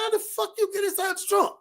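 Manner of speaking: can't believe what he hears; incredulous high yelp. Retold or imitated. Friend on phone hears brother is in the trunk, stunned high disbelief. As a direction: pitch jumps up in disbelief. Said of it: "I said".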